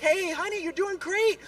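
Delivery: lightly voiced wife cheering him on; encouraging wife. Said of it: "She's trying to encourage me".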